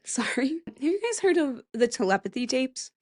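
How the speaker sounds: ordinary talk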